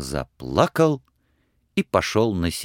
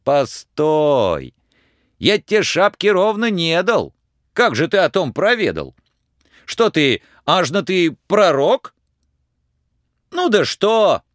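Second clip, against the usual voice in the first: high, excited, cheeky peasant lad; a simple lad protesting his innocence; pleading Ivan; 9 semitones higher than usual. Acted. Ivan denies hiding the feather from the tsar, protesting.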